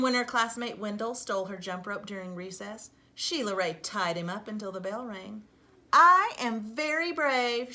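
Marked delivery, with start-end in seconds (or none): 5.9-7.7 loud, slow, proud declaration; proud boast. Acted. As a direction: puff up and announce it. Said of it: "Sheila Ray said, patting herself".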